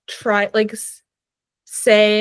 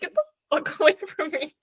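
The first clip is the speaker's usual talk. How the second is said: laughing rebuff; 5 semitones higher, 5 dB quieter than usual. Acted. Laughing through an imagined rebuff.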